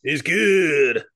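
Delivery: loud, drawn-out "good"; mock-enthusiastic echo, pushing out GOOD; hammed-up catchphrase. Co-host echoing the beer verdict, hammed-up and loud.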